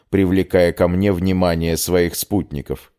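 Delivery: plain reading voice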